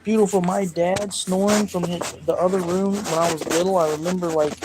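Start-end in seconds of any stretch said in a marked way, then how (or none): none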